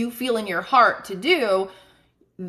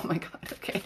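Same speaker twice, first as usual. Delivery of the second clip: breathless whisper; 4 semitones lower, 11 dB quieter, faster than usual. Real, not acted. Startled by card accuracy.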